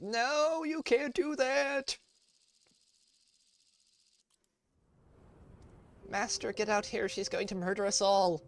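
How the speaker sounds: dog panicking in a squeaky high voice; voicing the dog. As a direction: squeal it out like a frantic little dog. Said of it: "the dog is like".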